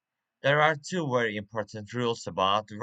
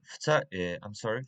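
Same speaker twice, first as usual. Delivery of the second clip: ordinary talk, nothing marked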